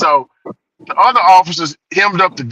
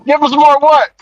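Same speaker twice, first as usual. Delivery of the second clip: high, baffled officer asking back; mock puzzled cop; confused cop; 8 semitones higher, 4 dB louder than usual. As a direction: play the confused partner, pitched high. Second officer confused question.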